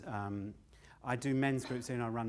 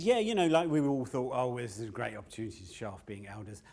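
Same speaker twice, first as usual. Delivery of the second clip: mocking self-important elders, rising to a laugh; smug elder; 6 dB louder than usual. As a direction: play the cocky old men showing off. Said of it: "and so he said".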